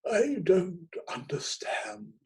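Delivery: slow, high stage whisper; hoarse whisper. A narrator's reading. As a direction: breathe it like a ham actor. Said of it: "in a kind of hoarse whisper".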